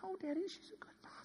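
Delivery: put-on high, quick, soft little-girl protest; tiny high-pitched mock child voice; four-year-old daughter. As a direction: imitate a small child in falsetto. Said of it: "my four-year-old".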